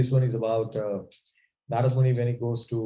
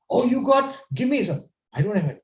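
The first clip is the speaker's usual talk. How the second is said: angry Parvati; 7 semitones higher, 3 dB louder than usual. Retold or imitated. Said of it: "She was very angry".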